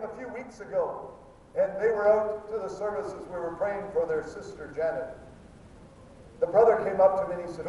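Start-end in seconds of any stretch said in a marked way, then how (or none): none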